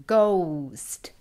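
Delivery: GHOST stretched, O gliding into U; slowed-down demo. Shown as a demonstration. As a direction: draw out both parts of the vowel in GHOST. Teacher copies the drawn-out example word.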